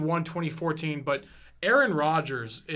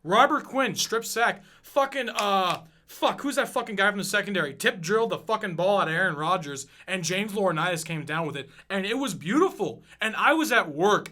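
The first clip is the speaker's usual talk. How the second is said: usual speaking voice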